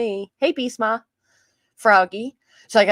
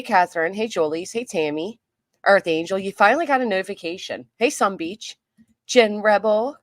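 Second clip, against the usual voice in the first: usual speaking voice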